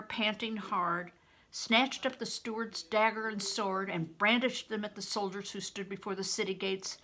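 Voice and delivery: plain reading voice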